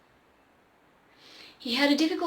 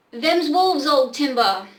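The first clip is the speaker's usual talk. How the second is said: loud, higher urgent call; 4 semitones higher, 9 dB louder, slower than usual. Acted. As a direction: call it out louder. Cowboy announces wolves.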